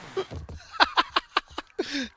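long squeaky laughing fit; high giggling laugh. Real, not acted. Keeps laughing at failure.

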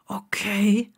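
unvoiced mumble; nervous, barely there; murmured. A narrator's reading. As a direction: pale Neville answering nervously. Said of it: "murmelte Neville".

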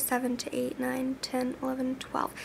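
counting softly under breath; muttered counting. Real, not acted. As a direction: quietly count it out to yourself. Counting hours under her breath.

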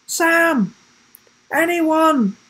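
high, urgent calls; calling out. A narrator's reading. Trapped boy calling through the wood, crying out. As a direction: call into the distance for anyone.

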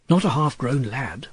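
usual speaking voice

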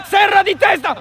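high loud shout; excited shout. Real, not acted. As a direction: shout the header. Header called live. (grido concitato)

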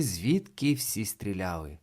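ordinary delivery, nothing marked

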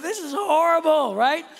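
high, loud dismayed cry; half-laughing inner wail on the slope; exclaiming. Retold or imitated. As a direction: cry it out in mock despair. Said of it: "I'm thinking to myself".

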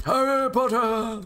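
high, drawn-out goofy call; silly put-on voice. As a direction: stretch it out in a high, mock voice.

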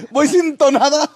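slow, laughing mock outrage; amused pretend indignation; laughing protest. Real, not acted. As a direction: ask it through a laugh. Teased about singing off-key.

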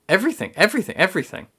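each everything pitched high; rising exasperation. Real, not acted. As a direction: pile them up, exasperated. Emphatic building list.

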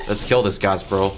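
normal talking voice

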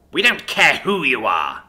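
growled contempt. A narrator's reading. Isadora dismisses Jack's introduction, angry growl. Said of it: "Isadora growled".

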